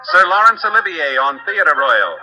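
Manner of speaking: ordinary delivery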